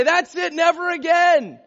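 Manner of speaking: loud, high dramatic vow to quit; hammed-up frustrated declaration, slowing at the end; mock giving up. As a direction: act out a big exasperated outburst. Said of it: "We don't say".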